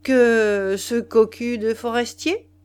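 slow, loud, sneering rising question; smiling mockery. Husband mocking dead rival to his wife, slow sneer. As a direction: drawl out the insult with a smile. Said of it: "il sourit et répondit".

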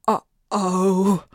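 dismayed uh-oh; worried uh-oh. A narrator's reading. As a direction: groan of dread. Dog dreading the vet.

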